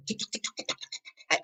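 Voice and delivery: breathless, whispered staccato tick tick. Retold or imitated. Urgent biological clock now ticking, whispered staccato. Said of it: "But now It was in staccato".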